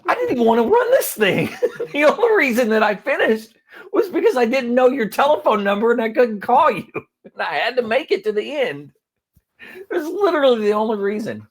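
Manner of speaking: high, loud, laughing outrage; laughing disbelief. Retold or imitated. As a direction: blurt it out in disbelief, laughing through it. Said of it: "I'm like".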